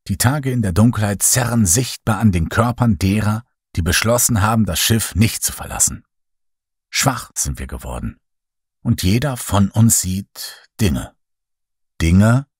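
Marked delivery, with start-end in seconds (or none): none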